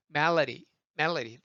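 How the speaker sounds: 멜로디 as "MEL-luh-ree", running straight through; relaxed American melody; smooth, easy flap. Shown as a demonstration. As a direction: glide through 멜로디 with a tapped D. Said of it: "쭉 편해요".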